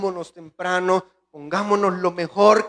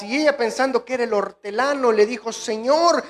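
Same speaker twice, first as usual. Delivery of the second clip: loud, high preaching of Scripture; impassioned pulpit reading of John twenty; fiery preaching; 5 semitones higher, 6 dB louder than usual. Acted. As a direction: preach the Gospel passage out at full force. Reads Scripture at full preaching force.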